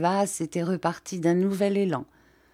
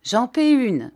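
declared; 6 semitones higher, 6 dB louder than usual. A narrator's reading. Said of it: "déclara".

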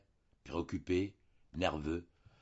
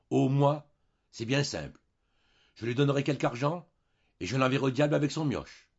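higher and louder, scornful; dismissive; 7 semitones higher, 8 dB louder than usual. Acted. Husband proposing to pay off the girl, dismissive. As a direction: wave the problem away.

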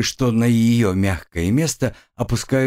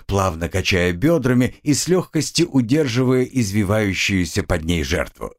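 ordinary delivery, nothing marked

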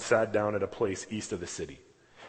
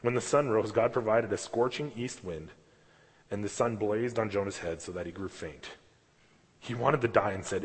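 normal talking voice

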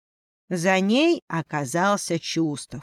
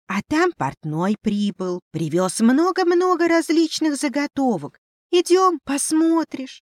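высокий оживлённый голос зверька; радостно зазывать, как маленький фамильяр; радостный мышонок; 9 semitones higher, 4 dB louder than usual. Acted. Мышь радостно зовёт. (радостный писклявый мышонок)

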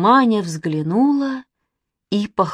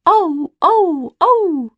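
high, loud yapping howls; sharp imitated animal yelps; barking; 11 semitones higher, 6 dB louder, much slower than usual. A narrator's reading. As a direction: bark like a wild jackal. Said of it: "как залает".

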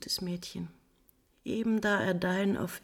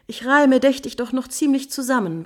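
loud retort; 5 semitones higher, 7 dB louder than usual. Acted. Countess defends her sanity angrily.

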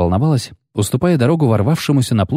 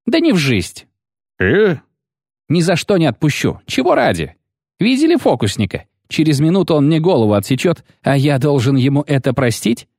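higher, squeaky outraged poet; a vain poet refusing out of spite; indignant Lutik; 7 semitones higher than usual. A narrator's reading. Condemned poet refuses to pardon his executioner, high and indignant.

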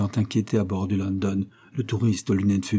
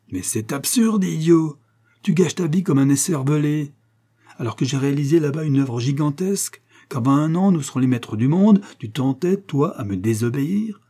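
raised, higher furious scolding; father berating his son, angry and raised; 6 semitones higher, 3 dB louder than usual. A narrator's reading.

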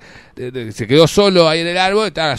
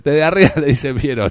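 normal talking voice

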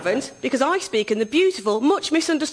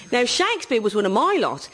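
usual speaking voice

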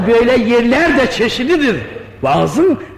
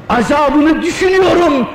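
crying out, weeping; 5 semitones higher than usual. Acted. The weeping youth tells the old man he cries over God's punishment, rising to a wail. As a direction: let the fear of punishment break into a cry. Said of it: "onun için ağlıyorum".

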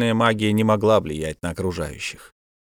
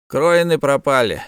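plain reading voice

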